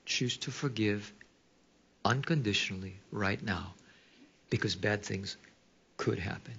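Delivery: soft voice of God urging forgiveness; voice of the Lord. Retold or imitated. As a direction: deliver it like a gentle inner command. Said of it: "you can hear the Lord going".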